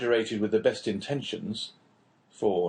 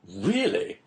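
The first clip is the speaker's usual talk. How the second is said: mild exclamation; 8 semitones higher than usual. Acted. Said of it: "exclaimed the Englishman".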